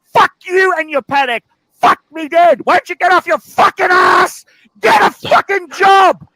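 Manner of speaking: furious yelling. Acted. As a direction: explode at her over the watch, cursing. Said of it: "Is he yelling at me still?".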